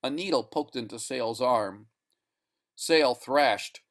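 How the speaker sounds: ordinary delivery, nothing marked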